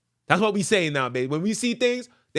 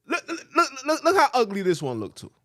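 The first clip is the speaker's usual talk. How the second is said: mocking laugh; 7 semitones higher than usual. Mocking ugly picture, laughing.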